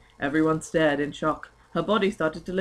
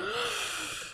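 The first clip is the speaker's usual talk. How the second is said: mock panicked gasp; 9 dB quieter than usual.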